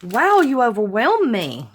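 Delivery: ordinary talk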